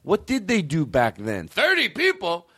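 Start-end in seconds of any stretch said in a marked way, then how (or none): 1.5-2.5 high, slow furious shouting at the crowd; a star refusing a tiny crowd, yelling; drawn-out outraged tirade; indignant shout. Retold or imitated. Re-enacting the comic storming out.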